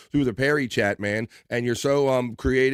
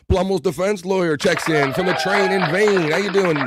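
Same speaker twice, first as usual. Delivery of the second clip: loud, higher hyped welcome; louder, higher hype greeting for a viewer; excited shout-out; 7 semitones higher, 6 dB louder than usual. Real, not acted. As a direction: announce him like a big arrival. Hyping a chat arrival.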